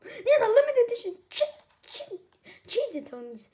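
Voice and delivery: high, loud start breaking into a stutter; growl. A narrator's reading. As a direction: stammer it out in outrage. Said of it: "he growled".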